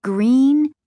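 ordinary delivery

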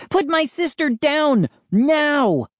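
high-pitched urgent demand; breathless shout. A narrator's reading. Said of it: "I shouted breathlessly".

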